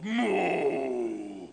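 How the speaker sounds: long, loud moo; imitated cow moo. Giant cow at a small boy in the barn, loud moo. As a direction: bellow like a huge cow. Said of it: "looks at Nathan and goes".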